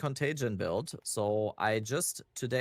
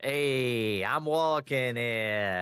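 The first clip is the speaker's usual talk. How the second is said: slow, drawn-out New York tough guy; New York accent; 4 dB louder, much slower than usual. Pretend. Repeats famous movie line.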